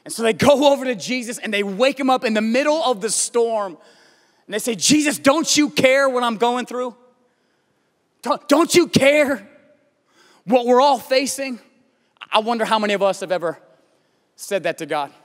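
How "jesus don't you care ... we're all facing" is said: raised, insistent plea; pleading. Acted. Disciples to sleeping Jesus in the storm, urgent pleading. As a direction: cry out in urgent appeal.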